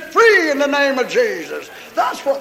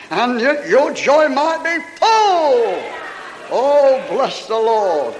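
preaching scripture; 3 semitones lower than usual. Acted. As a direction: proclaim each verse to the crowd. Preaches John fifteen at full force.

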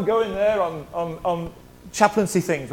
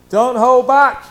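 loud, higher call to the room; urging shout; 4 semitones higher, 10 dB louder, much slower than usual. Real, not acted. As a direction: cry it out to push them on. Exhortation repeated.

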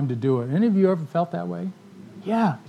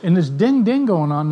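ordinary delivery

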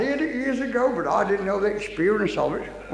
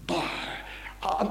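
normal talking voice

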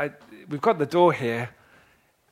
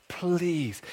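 begging; 10 dB quieter than usual. Acted. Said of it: "he begs his eldest son".

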